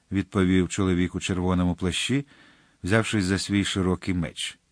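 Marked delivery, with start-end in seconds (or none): none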